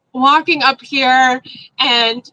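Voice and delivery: normal talking voice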